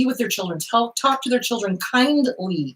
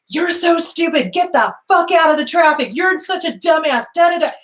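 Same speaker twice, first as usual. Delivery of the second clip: mimicked mother yelling, pitched higher; imitating screaming mother; 7 semitones higher than usual. Mother screaming at kids in parking lot, imitated higher. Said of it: "screaming at the poor kids".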